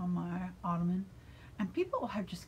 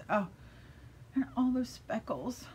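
normal talking voice

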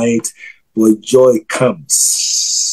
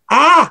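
sudden high-pitched cry of "ah"; sharp, impassioned cry; high exclaimed "ah"; 13 semitones higher than usual. Real, not acted. Preacher crying out while prophesying to Jackie, impassioned.

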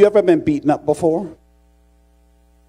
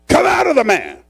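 high, loud preacher's imitation of Jesus; sudden thundered order to a demon; exorcising command; 13 semitones higher, 5 dB louder than usual. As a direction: act out a forceful casting-out.

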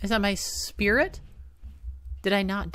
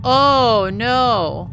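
scared yelp; 5 semitones higher, 8 dB louder than usual. Real, not acted. Monster appears, scared.

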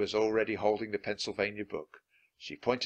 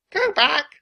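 very high, loud bird voice; parrot squawk; 9 dB louder than usual. A narrator's reading. Parrot warns the pirates, squawking high and loud. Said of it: "she squawked".

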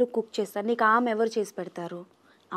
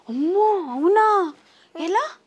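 mock surprise; 5 semitones higher, much slower than usual. Reacting to child's boogeyman claim.

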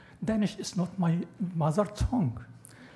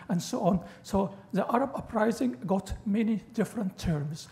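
ordinary talk, nothing marked

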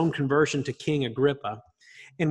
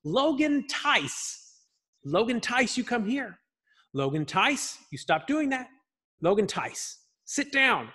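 a hint of a scolding mother, higher, drawing out the name; mock stern mother; 8 semitones higher, slower than usual. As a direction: call a misbehaving child to order, half in play. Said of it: "Tracy would say his first and middle name".

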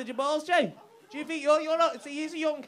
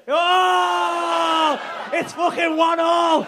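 high, loud, slow victory yell; triumphant shout; 9 semitones higher, 16 dB louder, much slower than usual. Real, not acted. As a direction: bellow it like a goal went in. Celebrating a point scored in banter.

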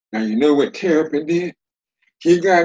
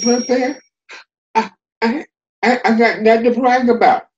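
higher, stammering little turtle voice; meek Terrapin; 9 semitones higher than usual. Acted. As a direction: stammer it out bashfully. Terrapin answers meekly.

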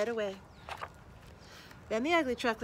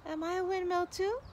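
put-on high, hopeful childlike question; hopeful little truckling; 9 semitones higher than usual. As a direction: ask it wishing the answer is yes. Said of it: "said the windmill".

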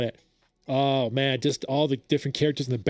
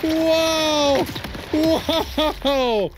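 high, loud cries of wonder; amazed cheering; 11 semitones higher, 4 dB louder, much slower than usual. Real, not acted. Fan stunned by the trailer, loud amazed cries. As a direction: shout in awe at the screen.

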